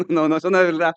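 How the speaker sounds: ordinary talk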